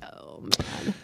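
hushed, voiceless sigh of words; weary dismay. Retold or imitated. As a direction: let it out under your breath. Weary parent's retold dismay.

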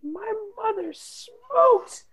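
put-on high, crying child voice; whiny child. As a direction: wail it like a pitiful sick kid. Melodramatic sick child pleading.

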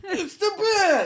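very high, drawn-out Brooklyn cry; Brooklyn henchman yell. Acted. Brooklyn henchman spotting Batman, high slow yell. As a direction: stretch it out high in mock Brooklyn. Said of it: "henchman in an Arkham game who yells".

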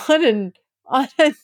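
ordinary talk, nothing marked

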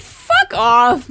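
loud, incredulous outburst; amused disbelief. Real, not acted. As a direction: blurt it out loudly through amusement. Laughing at the absurd fight challenge.

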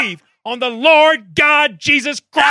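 full revival preacher yell; preacher shout. Pretend.

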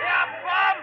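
loud jeer at the ring; heckling shout. Acted. Fan heckling Peachy from ringside, loud jeer. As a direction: boo him with words.

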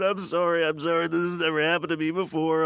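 high, blubbering apology, hammed up; mock tearful. Overwhelmed fan apologizes to puppeteer, imitating tearful blubbering. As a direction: babble an apology through pretend sobs. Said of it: "I burst into tears".

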